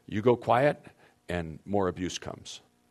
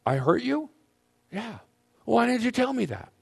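much higher, wide-eyed whoa and questions; startled surprise; 12 semitones higher than usual. Retold or imitated. Husband stunned by what his wife just said, much higher and startled. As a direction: stop her in shock. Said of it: "I said".

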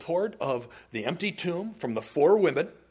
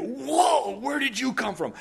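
startled disciples; 8 semitones higher than usual. Retold or imitated.

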